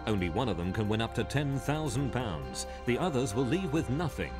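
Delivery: ordinary talk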